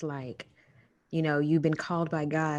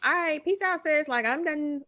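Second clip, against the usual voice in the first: higher-pitched mock sign-off, tossed out; breezy dismissal; 9 semitones higher than usual. Imitating someone cutting a date loose, breezily dismissive. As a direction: toss off an airy, higher-pitched goodbye.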